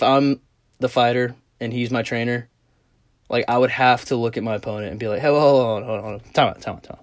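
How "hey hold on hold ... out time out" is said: quick, repeated hold-on patter; calling a pause. Acted. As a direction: hurriedly wave off an opponent mid-fight. Acting out pausing the fight.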